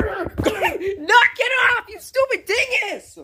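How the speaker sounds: high-pitched irritated telling-off; annoyed scolding. Real, not acted. Boy telling off his co-host for messing about, annoyed scolding.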